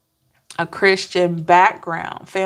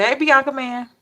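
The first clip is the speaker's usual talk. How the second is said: catchphrase greeting; 6 semitones higher than usual. Shown as a demonstration. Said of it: "that's how I'ma say it".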